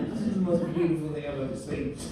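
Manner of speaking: usual speaking voice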